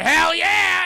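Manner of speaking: high, loud whooped yell; mock eager shout. Retold Catherine agrees to sign, in a whooping shout. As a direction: holler it with overblown enthusiasm.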